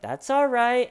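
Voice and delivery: mock gentle detective. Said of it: "the detective is like".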